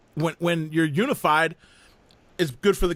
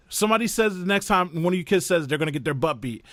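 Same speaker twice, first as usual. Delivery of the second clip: usual speaking voice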